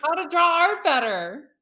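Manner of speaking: high, slow, laughing self-defence; laughing protest. Real, not acted. Artist defending their tracing to teasing friends, laughing protest.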